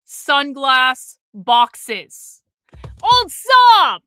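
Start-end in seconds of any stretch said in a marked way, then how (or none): 3.0-4.0 high, loud burst of excitement; excited shout-out. Real, not acted. Streamer excitedly thanks a donor in chat. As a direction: cheer the donor's name.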